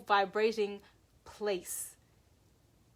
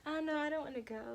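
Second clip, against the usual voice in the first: high, whiny reluctance; mock-helpless high-pitched protest; falling into a trance; 6 semitones higher, 4 dB quieter, much faster than usual. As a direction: waver in a small raised voice. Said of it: "Getting right back into that trance".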